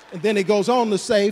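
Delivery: usual speaking voice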